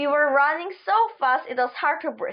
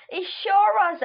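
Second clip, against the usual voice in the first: out of breath; 7 semitones higher than usual. A narrator's reading. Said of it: "We were still puffing so hard".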